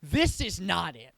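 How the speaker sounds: fed-up, punchy dismissal of a team; irritated. Real, not acted. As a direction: lay down each verdict with rising irritation. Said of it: "I'm getting irritated".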